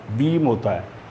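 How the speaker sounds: ordinary talk, nothing marked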